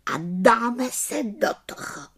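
lightly voiced, eager small witch; high, pleased witch voice; contented little witch. A narrator's reading. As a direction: get started with satisfaction, pitched high. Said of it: "spokojeně zvolala malá čarodějnice".

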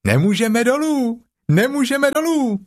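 very high excited little voice; a joey calling down, thrilled; Roo calling. A narrator's reading. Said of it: "zvolal klokánek". (klokánek nadšeně volá ze stromu)

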